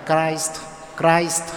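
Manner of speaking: "Christ" said the English way with a long i; English "Christ" with an eye vowel; English pronunciation. Shown as a demonstration. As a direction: say "Christ" to rhyme with "iced". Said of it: "Tiếng Anh người ta đọc là".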